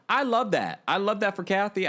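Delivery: excited. Real, not acted. Gushing approval.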